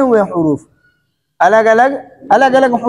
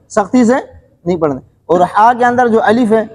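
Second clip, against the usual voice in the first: ordinary talk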